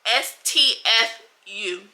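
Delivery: ordinary delivery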